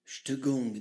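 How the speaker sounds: deep hushed shaman intoning; Chaman Chorizo. Acted. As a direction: murmur it like a solemn guru. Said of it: "Chaman Chorizo".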